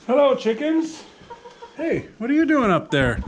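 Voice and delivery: high, singsong hello to pets; high-pitched animal talk; greeting animals. Real, not acted. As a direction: greet the chickens in a lifted pitch. Greeting his chickens.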